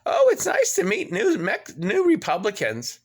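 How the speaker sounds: high, sing-song mocking "Oh, it's nice"; sneering at the cheery review; mock reviewer. Reading the Google review in a high mocking voice. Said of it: "a Google review I found that says".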